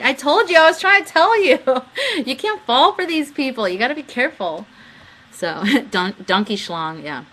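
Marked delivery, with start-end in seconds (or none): 0.0-4.7 high, loud laughing scold; told-you-so. Retold or imitated. Scolding David after the chat, laughing told-you-so. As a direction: gloat and warn at once. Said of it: "I'm like".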